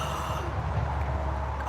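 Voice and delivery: long mock-shocked gasp; hammed-up intake of breath; theatrical gasp. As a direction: gasp like a scandalized courtroom. Gasp inside the reenacted courtroom scene.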